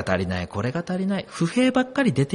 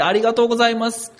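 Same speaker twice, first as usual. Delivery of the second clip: quick, bright thank-you; grateful thanks; 6 semitones higher, 3 dB louder, faster than usual. Retold or imitated. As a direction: toss off a happy thanks. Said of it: "恵みを数えて". (明るく速い感謝の言葉)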